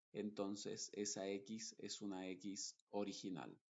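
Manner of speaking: ordinary delivery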